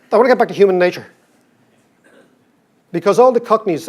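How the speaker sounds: ordinary delivery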